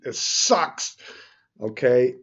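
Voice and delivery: exasperated venting. Real, not acted. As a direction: blurt it out, fed up. Venting about the timing sheet.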